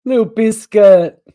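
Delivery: loud doting coo over a cat photo, slow. Real, not acted. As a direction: coo the cat's name fondly.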